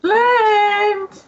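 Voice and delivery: high, drawn-out call. Acted. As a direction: summon him sharply from downstairs. Milva calls Flint from below.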